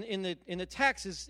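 ordinary talk

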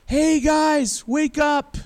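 high, loud shout across to the camp; yelling wake-up call. Retold or imitated. David shouting down from the hill to sleeping soldiers, loud high yell. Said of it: "David yells at Saul".

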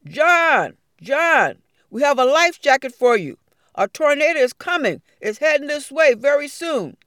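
loud urgent call to John. Acted. Rescuers shouting a tornado warning to John, loud.

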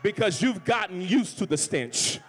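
loud sermon delivery; preaching. Acted. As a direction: drive it home to the crowd. Preaching resumes.

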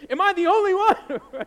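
loud, high and laughing; laughing appeal. Real, not acted. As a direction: call it out to the crowd through a laugh.

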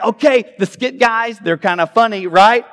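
loud, high teasing hype call; baiting the crowd. Retold or imitated. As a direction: fish for a cheer from the audience. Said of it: "And he was like".

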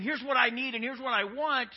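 loud, high mock demand. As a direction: mimic someone insistently listing demands at God. Mimics demanding prayer instead of listening.

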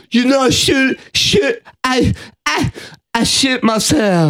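high stammering character voice; stammering Elephant Boy. Retold or imitated. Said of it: "I like when he starts stammering".

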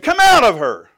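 high, loud exorcist's command; commanding shout. Retold or imitated. Preacher ordering a demon out of a woman, commanding shout.

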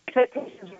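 breaking up on a bad line; dropping out like a weak connection; bluetooth cutting out. Real, not acted. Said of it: "we're losing you there".

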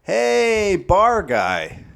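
high, playful call-out; mock hailing call. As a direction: hail a lowly rank with mock cheer. Joke greeting by comedy rank, calling out.

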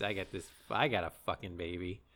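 low, soft dumb dude boast; mock dude voice. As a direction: mumble it like a clueless new dad. Voicing Rob boasting.

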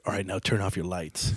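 hushed command in the dark; low guide instruction. Retold or imitated. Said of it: "He's like".

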